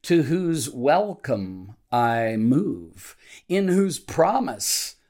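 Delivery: measured devotional reading with deliberate pauses between phrases; slow prayer reading. Real, not acted. Pastor reading a morning prayer to devotional listeners, slowly and deliberately. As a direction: read the prayer slowly, setting each phrase apart. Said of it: "I'm gonna read it slowly".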